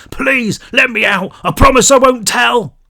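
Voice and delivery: high, loud desperate cries from inside; desperate muffled pleading. Acted. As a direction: beg to be let out, muffled. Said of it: "the voice got louder, still muffled".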